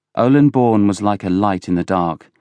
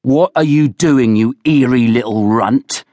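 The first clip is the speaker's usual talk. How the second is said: loud, raised-pitch angry roar; 5 semitones higher, 5 dB louder than usual. A narrator's reading. Villiers hauls Oland from under the table, roaring. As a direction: roar it down at a cowering boy. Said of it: "roared Villiers".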